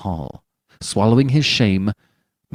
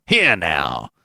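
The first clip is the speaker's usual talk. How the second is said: irritated; 5 semitones lower than usual. Acted. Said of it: "cried Doctor Keene in a perfect passion of irritation".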